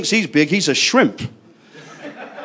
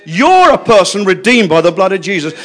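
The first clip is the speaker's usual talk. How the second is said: ordinary talk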